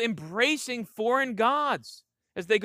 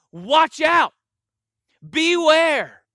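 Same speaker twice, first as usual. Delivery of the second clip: much higher and louder, a near-shouted watch out, beware; urgent loud warning; 7 semitones higher, 9 dB louder, much slower than usual. Shown as a demonstration. Pastor shows the congregation Jesus's severe warning, near-shouted and higher. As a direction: cry it out like a command with maximum urgency. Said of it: "And then twice".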